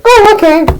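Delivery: little falsetto concession; high-pitched reply. Retold or imitated. Friend answers being blamed for the hair, in falsetto. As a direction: squeak out the acted reply.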